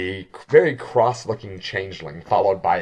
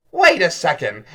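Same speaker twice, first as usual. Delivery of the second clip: raised, louder exclamation; sudden halt; 6 semitones higher, 7 dB louder than usual. Acted. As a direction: stop everyone short. Character stops short and calls out.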